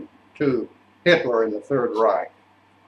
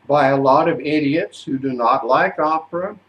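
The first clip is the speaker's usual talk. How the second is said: ordinary talk, nothing marked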